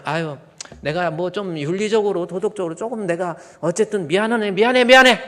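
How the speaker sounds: rising to a shrill mock cry; hammed-up reluctant sorry, building loud; grudging apology. (마지못해 하는 과장된 사과)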